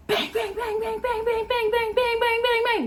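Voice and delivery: high repeated bang bang; imitating the woman pounding on the car window; mock banging. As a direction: rattle off the banging noises.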